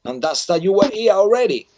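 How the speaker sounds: high, loud barker's call; loud carnival barker. Acted. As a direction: bellow it like a carnival barker working the crowd. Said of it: "That's why I speak so loud".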